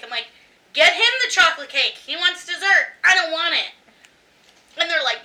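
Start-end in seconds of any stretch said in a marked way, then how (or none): 0.7-3.7 insistent. Retold or imitated. Insisting on refusing dessert.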